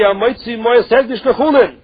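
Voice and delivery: usual speaking voice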